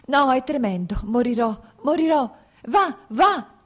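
urlo acuto e disperato, molto più forte; grido di dolore. Acted. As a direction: gridare in preda al dolore del parto. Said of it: "Ella gridò". (Kitty urla al marito di andarsene, grido straziato)

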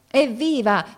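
high, loud narrated hurrah; sudden high cry of acclaim; shouted cheer. A narrator's reading. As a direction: cheer it out like an excited soldier. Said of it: "E noi gli gridammo".

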